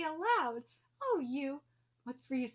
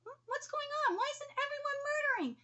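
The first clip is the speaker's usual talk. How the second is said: much higher, puzzled put-on voice; 11 semitones higher than usual. Villain puzzled that nobody is fighting, high voice. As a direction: ask it high and baffled. Confused villain breaking scene.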